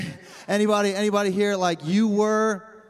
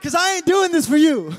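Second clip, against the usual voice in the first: loud, drawn-out, unbothered defiance; defiant David; 10 semitones higher, 9 dB louder than usual. Acted. David answering his scolding wife, loud and defiant. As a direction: belt it back proudly, stretching the first words. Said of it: "And David's like".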